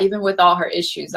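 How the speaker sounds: normal talking voice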